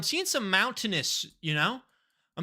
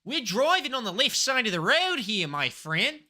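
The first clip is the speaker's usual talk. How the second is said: hammed-up Aussie mate, lively and higher; playful, swinging Australian accent; 5 semitones higher, 3 dB louder than usual. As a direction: put on a broad Australian accent. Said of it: "I do an accent of where I think I am".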